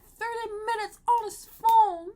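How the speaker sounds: loud, high-pitched indignant rant; exasperated outburst. Real, not acted. Venting about the dude on his phone, loudly exasperated. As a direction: raise your voice in exasperation.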